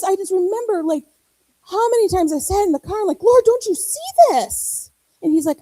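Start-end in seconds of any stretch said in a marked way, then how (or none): 3.2-4.9 higher, slow, drawn-out plea; retold exasperated prayer, high and slow; pleading complaint. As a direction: whine at God to notice.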